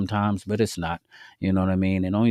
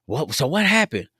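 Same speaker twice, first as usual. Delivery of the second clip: much higher mock demanding voice; imitating angry girlfriend; 5 semitones higher than usual. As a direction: imitate her demanding answers over the phone. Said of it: "I can just hear her in the background like".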